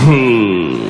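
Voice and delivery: low "who" that just slides out; slack-lipped mumble. Retold or imitated. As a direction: let it ooze out with no lip effort. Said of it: "It just slid out".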